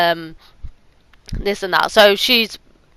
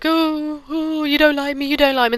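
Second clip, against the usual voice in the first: high, whiny fake sobbing plea; a hammed-up weepy wail; mock crying; 8 semitones higher than usual. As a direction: imitate her pitiful fake crying. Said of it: "she starts crying".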